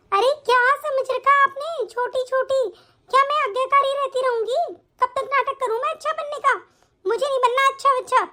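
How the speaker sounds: high, indignant protest; angry outburst. Acted. Daughter-in-law rejecting the good-girl act before her family, angry outburst. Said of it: "इतना गुस्सा तो कभी नहीं किया उसने".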